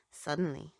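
ordinary delivery

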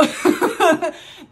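giggling fit, high and loud; high burst of laughter. Real, not acted. Reader laughing at her own crude words. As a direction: crack up at what you just said.

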